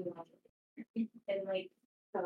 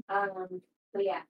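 ordinary talk

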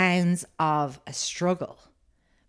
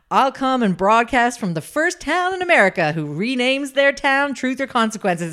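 higher and louder mock showman announcing; hammed-up radio host; 6 semitones higher, 5 dB louder than usual. Radio host announcing his challenge to America, in a hammed-up showman voice. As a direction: sell it like a big radio promo. Retold radio host's promise.

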